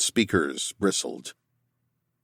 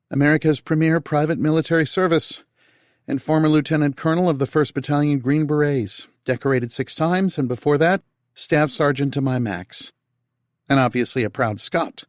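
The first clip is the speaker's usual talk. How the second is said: higher, teasing recital; Tal's voice; 8 semitones higher than usual. A narrator's reading. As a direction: show off that she looked him up. Tal recites his résumé.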